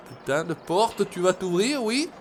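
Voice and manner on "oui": ordinary talk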